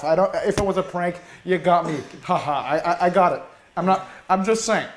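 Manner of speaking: ordinary talk, nothing marked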